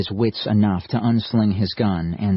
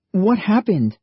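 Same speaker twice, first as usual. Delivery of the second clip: shocked; 11 semitones higher, 4 dB louder than usual. A narrator's reading. Sudden disappearance.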